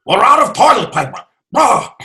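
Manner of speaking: high, loud panicked inventor; Doc Brown. Acted. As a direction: cry out in mock panic, ending in a yelp. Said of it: "What if we are four Doc Browns".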